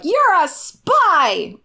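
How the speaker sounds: high, slow, drawn-out accusing cry; dramatic mock reveal of the spy; mock accusation. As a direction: point at the spy and gasp it out slowly. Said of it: "once you're like".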